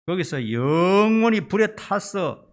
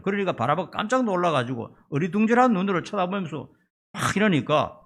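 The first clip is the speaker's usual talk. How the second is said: usual speaking voice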